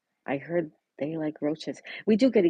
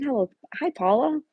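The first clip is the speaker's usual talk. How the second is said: high, louder hi to a friend; light up at a familiar name; much higher, bright hello; excited greeting; 9 semitones higher, 5 dB louder than usual. Real, not acted. Greeting a viewer on stream.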